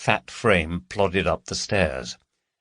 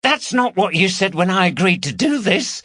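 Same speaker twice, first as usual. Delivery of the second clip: high-pitched, indignant protest; indignant Carol; 11 semitones higher, 4 dB louder than usual. A narrator's reading. Carol objecting to Gary mid burglary, indignant. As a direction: protest to your accomplice in a high voice.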